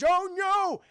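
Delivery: high, loud dismayed cry; exaggerated high-pitched horror; mock alarm. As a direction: wail it in hammed-up dread. Character reacts to danger at home.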